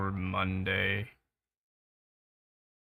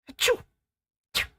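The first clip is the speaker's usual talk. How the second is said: quick fake sneeze; two sharp fake sneezes; sneeze sound; much faster than usual.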